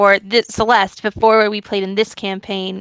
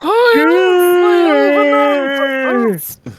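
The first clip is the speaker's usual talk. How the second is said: mystical elder voice; 6 semitones higher, 4 dB louder than usual. Pretend. Grand mock elven ancestry.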